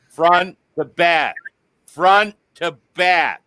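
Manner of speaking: "front to back" said slowly, every word spaced out; slow emphatic reminder. Shown as a demonstration. Slow mock lecture to all listeners. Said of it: "So remember, all you out there".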